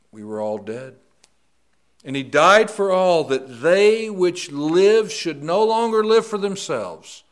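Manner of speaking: high, loud, slow scripture reading; preaching scripture. Acted. Preacher reading Second Corinthians to the congregation, full force. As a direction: proclaim it from the pulpit.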